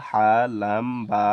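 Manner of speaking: usual speaking voice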